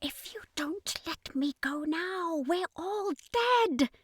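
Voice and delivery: high, slow, whispery alien voice; alien commander. Acted. Captive alien commander warning Florence, high slow whisper. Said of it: "the Hylexan commander insisted".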